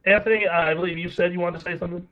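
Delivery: ordinary talk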